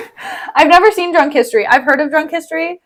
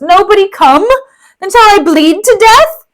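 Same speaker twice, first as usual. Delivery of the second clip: higher, louder wounded plea; desperate call; 7 semitones higher, 6 dB louder than usual. Acted. Wounded Rodrigo crying out for help, loud. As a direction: call out as if bleeding.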